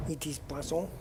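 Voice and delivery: poisson said the French way, pwa-SON. Shown as a demonstration. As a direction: keep poisson French, pwasɔ with a light rise. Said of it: "Yeah, poisson".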